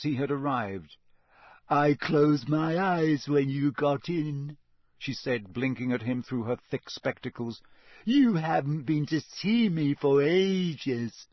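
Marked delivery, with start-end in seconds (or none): 1.7-4.6 put-on slow, drawn-out glum ghost girl. 8.0-11.3 put-on slow, higher, plaintive ghost girl.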